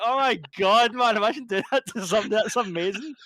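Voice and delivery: high, loud, laughing glee; acting out the director delighting in the prank, laughing; delighted laughing. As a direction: gush through laughter.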